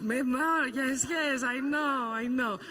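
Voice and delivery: put-on Greek accent. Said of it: "I can try to speak in Greek accent".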